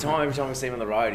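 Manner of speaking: normal talking voice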